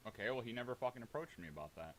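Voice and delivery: ordinary delivery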